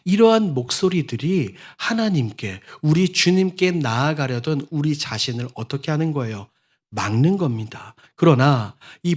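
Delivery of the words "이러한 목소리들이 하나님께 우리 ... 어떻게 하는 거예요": normal talking voice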